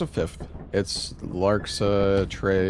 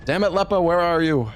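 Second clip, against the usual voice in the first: calling out; 10 semitones higher, 5 dB louder than usual. Real, not acted. Calling out for missing friend.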